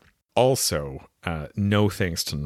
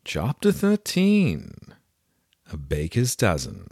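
high, dramatic storybook narrator intro; grand, measured title read; audiobook title announcement; 4 semitones higher than usual. Acted. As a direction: announce the chapter with theatrical flourish. Reads book title in story voice.

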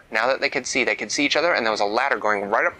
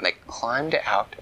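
ordinary delivery

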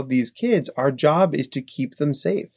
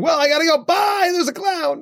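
much higher, shouted panicky bolt for the door; mock panic. Therapist pretending to flee a clown mid-podcast, high mock panic. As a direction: squeal it like you just spotted a clown and have to run.

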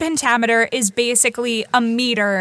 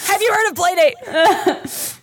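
slow, high mock reporter voice, laughing; lightly hammed interviewer joining the bit; street interviewer; 9 semitones higher, 3 dB louder, slower than usual. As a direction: drag it out like a TV host with a mic. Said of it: "we're like".

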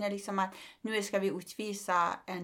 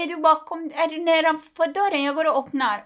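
higher, louder mock of mom at the door; mamma på telefonen; 8 semitones higher, 9 dB louder than usual. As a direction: play mom sure she's downstairs. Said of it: "och säger". (härmad mamma, ljusare och högre)

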